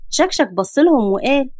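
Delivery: usual speaking voice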